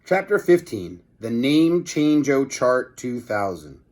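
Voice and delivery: plain reading voice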